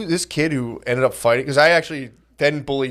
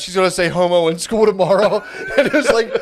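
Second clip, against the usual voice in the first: higher, giddy through real laughter; barely holding back the giggles; laughing; 4 semitones higher than usual. Real, not acted. Comic recalling childish glee about science class, laughing.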